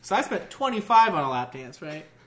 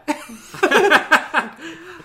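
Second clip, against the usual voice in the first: loud laugh; 10 semitones higher, 9 dB louder than usual. Real, not acted. Teller cracking up at his own story, loud laugh. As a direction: burst of high loud laughter.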